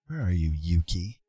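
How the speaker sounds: ordinary delivery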